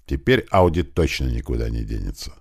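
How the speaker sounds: ordinary delivery, nothing marked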